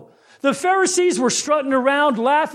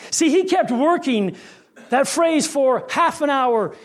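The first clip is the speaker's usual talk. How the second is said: normal talking voice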